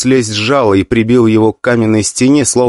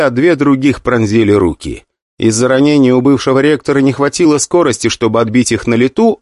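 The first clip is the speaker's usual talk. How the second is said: usual speaking voice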